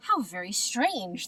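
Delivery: ordinary delivery, nothing marked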